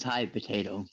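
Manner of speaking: a hint of a parrot's greeting; imitating her bird. Owner imitating her parrot's greeting, lightly voiced. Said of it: "the main thing she says is".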